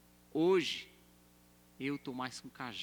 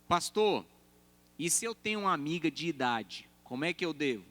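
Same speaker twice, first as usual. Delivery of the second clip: raised, louder asking voice of a church member; mock congregant question; 4 semitones higher, 6 dB louder than usual. Church member asking the pastor, raised and eager.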